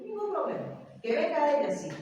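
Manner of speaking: high, loud, defiant reply; quoting herself telling the crying mother off. Retold or imitated. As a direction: raise your voice and pitch, standing your ground. Retells her firm answer.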